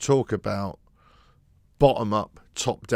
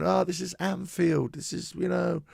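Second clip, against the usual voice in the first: higher, resigned fan voice; 6 semitones higher than usual. Retold or imitated. Fans at Anfield shrugging off the goal, resigned. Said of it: "there's fans going".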